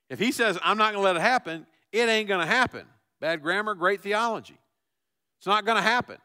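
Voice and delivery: loud, high, fast preacher's cry; preaching at full force. Real, not acted. Pastor preaching hard to the congregation, loud and high. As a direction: fire up the church.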